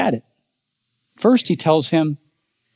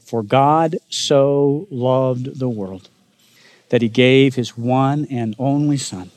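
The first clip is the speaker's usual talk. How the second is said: reading scripture aloud; 2 semitones lower, slower than usual. Acted. The preacher reads John three sixteen with the congregation, slow and solemn. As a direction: recite it together like a creed. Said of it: "Read this with me".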